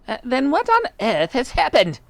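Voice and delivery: high, distressed wife's cry. A narrator's reading. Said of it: "cried Miss Twit".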